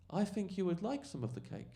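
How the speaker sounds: very high put-on comic voice; mock-innocent offer. Comic offering the heckler imaginary cake, high mock-innocent voice. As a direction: imitate a comic offering treats in falsetto.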